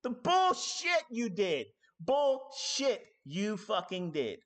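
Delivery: loud, high, slow outraged yell; furious shout. Real, not acted. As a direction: yell it at the liar. Shouting at liars.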